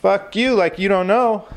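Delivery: high mock comeback; mock retort. Comic hassling a clone, high taunting retort. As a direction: fire it back up high, taunting. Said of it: "I'm like".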